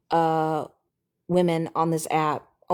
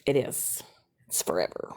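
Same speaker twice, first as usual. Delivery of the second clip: spooky low voice; 10 semitones lower, 7 dB quieter than usual. Pretend. Ominous low aside.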